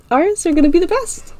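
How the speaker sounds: Ira, high and eager; bright, high friend voice; high girl voice. A narrator's reading. Ira boasting about their cookies, in a high, eager voice. Ira's voice.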